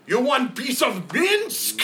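high, slow, loud confused bellow; a muddled brute demanding an answer; a brawler, loud and slow, baffled; dim brute voice. Acted.

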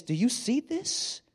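slow, soft, pointed mom question; light mimic of a mother scolding sideways; imitating a scolding mom. Mother scolding her son over the bathroom, softly pointed. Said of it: "My mother was great at that".

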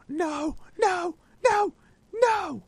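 high shouted no; shocked cries of no. Retold or imitated. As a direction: cry out in disbelief. Reacting to replayed loss.

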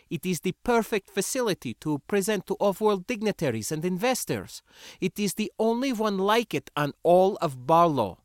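put-on heated, high-pitched accented lecture; scornful foreign rebel. Sindra telling the spacer how workers live, indignant. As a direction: explain it impatiently, voice pitched well up.

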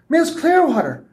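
high excited squeal. Acted. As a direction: squeal with joyful relief. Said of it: "Christine squealed".

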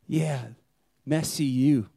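normal talking voice

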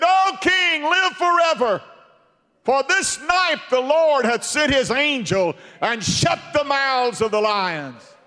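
high, loud joyful proclamation; loud high triumphant reply to the king; triumphant Daniel. Retold or imitated. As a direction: ring out the good news. Daniel answers from den.

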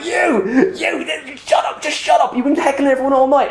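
high, frantic retold rant at a heckler; squeaky-high, worked-up scolding; manic telling-off. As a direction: reenact a manic, high-pitched outburst. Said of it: "but I was manic".

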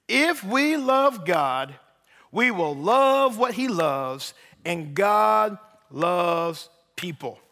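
very slow, deliberate, weighty phrasing; slowly, with intention. Shown as a demonstration. Preacher repeating for those who missed it, slow and intentional. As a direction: stretch each word out for emphasis. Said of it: "I'm gonna say it slowly with intention".

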